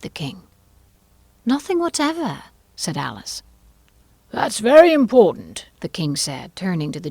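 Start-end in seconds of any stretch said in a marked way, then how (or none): 1.5-2.4 put-on high, firm little girl voice; firm young girl. A narrator's reading. Alice repeats firmly. 4.3-5.7 loud, high pompous declaration; pompous king. A narrator's reading. King pronounces to jury.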